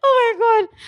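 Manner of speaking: high excited shriek. Real, not acted. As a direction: squeal it high. Overwhelmed by the compliment.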